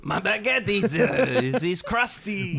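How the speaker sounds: high, loud mock French complaint; bad French accent. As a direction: ham up a dubious French accent. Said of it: "not French accent".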